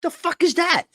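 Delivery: high-pitched shocked cry; shocked outburst. Retold or imitated. Retelling his reaction to the security sign, high shocked outburst. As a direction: blurt it out in high disbelief. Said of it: "I said".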